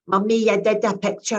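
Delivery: a hint of a proud little child; toddler voice. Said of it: "and goes".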